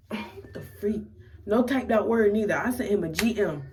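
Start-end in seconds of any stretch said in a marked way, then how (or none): 0.0-1.0 slow, unvoiced exasperated sigh-question; sighing at his reply; sighing disbelief. Real, not acted. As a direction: breathe it out in disbelief.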